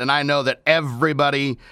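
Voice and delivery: ordinary talk, nothing marked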